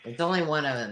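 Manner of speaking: normal talking voice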